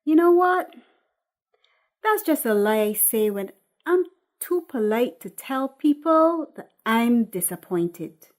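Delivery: put-on a woman calling out her stalling boyfriend; Patrice.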